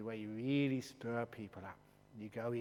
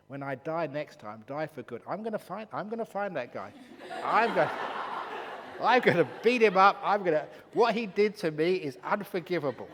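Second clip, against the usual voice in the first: hammed-up laughing rage, much louder; mock furious threat; 4 semitones higher, 12 dB louder than usual. Woman vowing revenge on the spirit, laughing mock fury. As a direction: laugh through a mock threat to beat him up. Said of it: "She was so pissed off".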